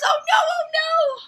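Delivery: high, loud panicked squirrel cry. Acted. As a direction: wail it like a terrified squirrel.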